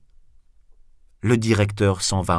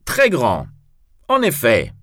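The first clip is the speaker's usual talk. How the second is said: mock-grave, raised agreement; serious; 4 semitones higher, 6 dB louder than usual. A narrator's reading. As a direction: agree firmly, short and loud. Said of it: "répond Cyrano d'un air sérieux".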